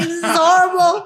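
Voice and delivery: high, loud, half-laughing sob; laugh-cry it in mock horror; hammed-up teary wail; tearful laughing despair. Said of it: "I cried".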